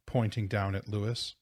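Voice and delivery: plain reading voice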